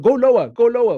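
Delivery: coaching call. Retold or imitated. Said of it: "when I, I told".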